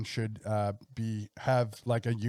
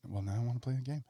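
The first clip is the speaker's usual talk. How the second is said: understated quote; 9 dB quieter, faster than usual. Retold or imitated. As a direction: say it softly, as if to yourself. Quotes Jared after the movie.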